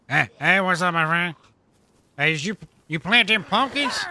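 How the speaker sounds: a high character voice hailing strangers, then cursing them; Uncle Tad voice. Acted. Uncle Tad begging players for help, then cursing them.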